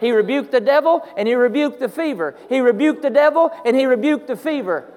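loud, high, building preacher's cry; fiery revival preaching; preaching at full force. Acted. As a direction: drive the point home to the congregation.

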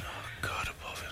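hushed whisper of mock dismay; whispered aside. Host recalling her reaction to the mam, whispering. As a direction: breathe it out under your breath. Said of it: "and I said".

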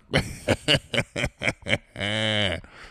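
put-on long staccato old-man cackle; drawn-out, stuttering mock laugh; laughing old grandpa. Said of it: "laughing with food coming out".

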